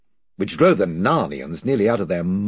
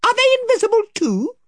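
much higher, squeaky little mouse voice; a brave tiny mouse asking; mouse Reepicheep; 5 dB louder, faster than usual. A narrator's reading. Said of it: "said Reepicheep".

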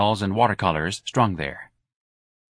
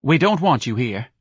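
tart; 4 semitones higher, 3 dB louder than usual. A narrator's reading. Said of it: "the spokeswoman said tartly".

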